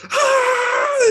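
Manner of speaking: high shrill scream bursting out of the void. Acted. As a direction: let out a loud terrified shriek. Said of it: "just screaming".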